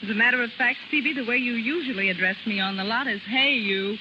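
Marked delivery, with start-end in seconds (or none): none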